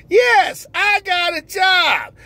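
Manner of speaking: high, slow, giddy voice of a man who just got a job; overjoyed new hire. Acted. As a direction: squeal it out like the happiest man alive. Character answers the interviewer.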